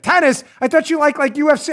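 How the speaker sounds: incredulous listener pushes it up high; mock surprise. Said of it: "You'd be like".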